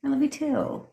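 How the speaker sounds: high, soft cooing reply; touched reply. Acted. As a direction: answer softly and high, as if melting. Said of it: "And you're like".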